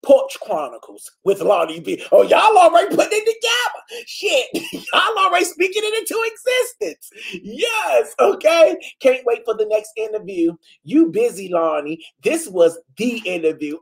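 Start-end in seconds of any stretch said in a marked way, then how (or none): 2.1-8.8 thrilled. Real, not acted. Thrilled by viewers naming the show.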